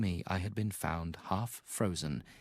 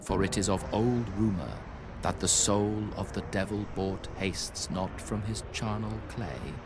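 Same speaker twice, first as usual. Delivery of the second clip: ominous incantation. A narrator's reading. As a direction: intone it like a curse from an old book. Quoting the Necronomicon passage.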